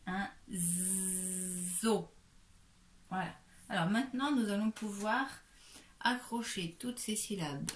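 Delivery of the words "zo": "zo" with long buzzing z; syllable demo. Shown as a demonstration. Teacher moves to the last syllable, drawn out. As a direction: hold the z before "zo". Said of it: "Et puis ici".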